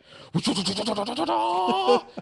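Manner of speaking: high, rapid-fire stuttered war cry; imitated whirling attack noise, rising; mock battle whoop.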